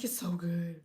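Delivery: breathy whispered daughter; imitating daughter in a whisper. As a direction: whisper it dreamily. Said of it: "She's like".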